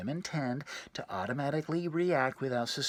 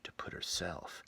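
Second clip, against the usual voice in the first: unvoiced whisper, much lower; 8 semitones lower, 9 dB quieter than usual. Telling the guys about her mood, whispered. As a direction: drop to a seductive whisper. Hushed seductive narration.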